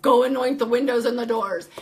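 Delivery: loud retold order; urgent command. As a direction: call it out as a brisk instruction.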